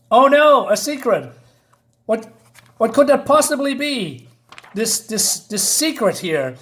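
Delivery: high, hammed-up fake dismay; mock suspense. Reviewer mocking the predictable secret, mock suspense.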